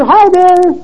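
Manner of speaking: laughing answer. A narrator's reading. Baby answers the old man across the restaurant, high and delighted. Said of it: "he continued to laugh and answer".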